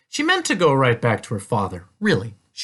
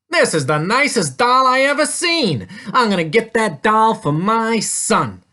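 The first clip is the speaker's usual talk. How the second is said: loud, raised, emphatic character voice; enthusiastic male shopper; 9 semitones higher, 5 dB louder than usual. A narrator's reading. Man wants the doll for his son, loud enthusiasm. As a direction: declare it boldly, punching the key words.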